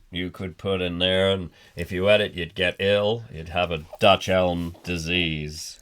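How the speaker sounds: drawling mascot. Pretend.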